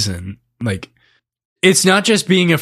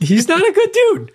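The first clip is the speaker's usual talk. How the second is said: high-pitched laughing echo. Real, not acted. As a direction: squeal it out while laughing. Echoing the line laughing.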